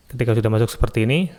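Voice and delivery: ordinary talk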